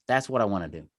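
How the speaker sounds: ordinary talk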